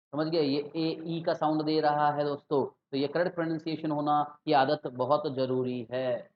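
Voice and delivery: normal talking voice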